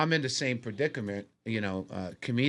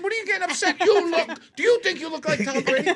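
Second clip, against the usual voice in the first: high, loud indignant question; imitating an annoyed buddy; mock upset friend; 8 dB louder than usual. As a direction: play the friend getting worked up. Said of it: "Anthony got upset".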